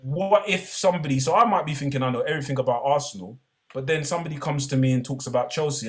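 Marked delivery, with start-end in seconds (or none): none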